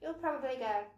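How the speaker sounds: ordinary talk, nothing marked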